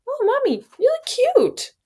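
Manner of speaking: put-on very high, sweet little-boy voice; toddler voice. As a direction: squeak it out like a two-year-old. Toddler son's voice quoted.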